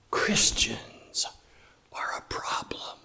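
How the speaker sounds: hushed stage whisper of a pundit's words; whispered quote. Retold or imitated. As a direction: breathe it like a secret.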